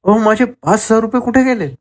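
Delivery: high-pitched cry of alarm, no added volume; narrated outcry. A narrator's reading. Stallholder finds five thousand rupees missing, alarmed outcry. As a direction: call out, pitch jumping well up. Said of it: "अचानक स्टॉलवाला ओरडला".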